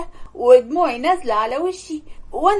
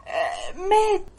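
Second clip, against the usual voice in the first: sobbing stepmother; 6 semitones higher, 5 dB quieter, slower than usual. Acted. Stepmother reports father's death.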